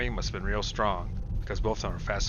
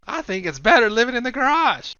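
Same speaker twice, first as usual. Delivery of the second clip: much higher, louder cheeky jab; snarky Will; 10 dB louder than usual. Acted. Will mocking Brian's small room, loud cheeky jab. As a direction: tease the room with a grin.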